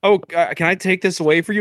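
hammed-up helpful manager; polite manager. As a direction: play the eager manager asking. Manager voiced in retold story.

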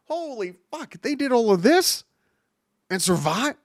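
astonished, high-pitched exclamation; stunned disbelief. Retold or imitated. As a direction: react like you can't believe it. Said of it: "I'd rather have the reaction of".

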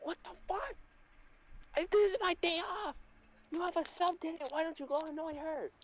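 very high-pitched, whiny annoyed teacher; hammed-up exasperation way up high, without shouting; pissed-off teacher. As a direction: complain in a squeaky put-on teacher voice. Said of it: "all pissed and shit".

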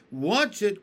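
loud, high sharp warning; warning cry. A narrator's reading. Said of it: "cried Miss Spider".

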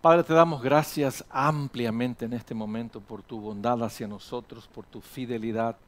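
low, soft pastoral prayer; oración. Real, not acted. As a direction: give thanks quietly, in measured phrases. Closing prayer. (oración de gracias, grave y suave)

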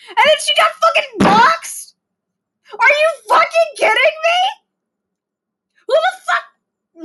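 a furious high cry about the doxxed minor; outraged shout. Real, not acted. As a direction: scream in disbelief at the injustice. Outraged rant about doxxing.

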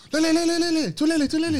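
very high, loud excited imitation of a Singaporean accent; mock Singaporean accent. Imitating an eager fan running up, in mock Singaporean accent. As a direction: squeal the name in a put-on Singaporean accent. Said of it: "I'm doing this guy's accent".

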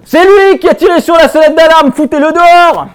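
very high, very loud denunciation; accusing shout. Acted. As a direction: scream the accusation to the whole bus. Dénonce le SDF à tout le bus. (dénonciation hurlée)

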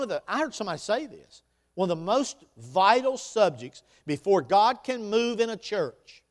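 loud, high, driving preacher voice; booming motivational speech. Acted. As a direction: preach it out to the room. Preaching at full force.

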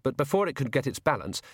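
plain reading voice

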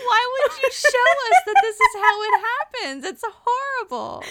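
high, loud laughing disbelief; laughing outrage. Real, not acted. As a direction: protest while cracking up. Outraged at the behind-the-scenes photo.

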